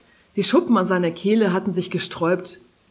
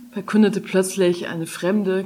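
plain reading voice

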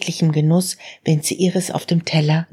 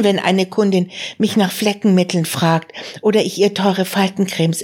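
put-on agitated young woman; 4 semitones higher than usual. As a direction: let Marie vent her pent-up frustration. Marie pours out frustration to uncle. (aufgebracht, höher und lauter, junge Frau klagt dem Onkel ihr Leid)

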